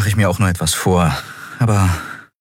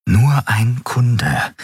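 slow mantra; 5 semitones higher, much slower than usual. Acted. Slow self-reassuring inner mantra.

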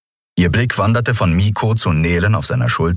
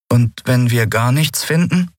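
higher, tentative question; mildly wary, higher character voice; cautious asking; 6 semitones higher than usual. Acted. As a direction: ask carefully, pitched up. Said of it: "fragte er vorsichtig".

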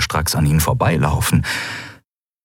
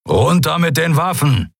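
barked order, higher and pressing; commanding; 8 semitones higher than usual. Acted. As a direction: order them to drop weapons. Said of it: "befahl Keru".

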